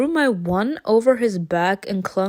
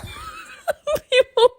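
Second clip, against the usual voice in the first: laugh-crying. Real, not acted. Dragon hurt, reacting.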